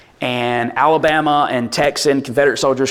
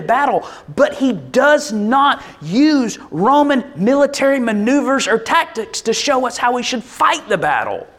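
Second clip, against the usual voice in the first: raised, earnest sermon voice; preaching; 8 semitones higher than usual. Acted. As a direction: drive the point home to the congregation. Preaches on Paul.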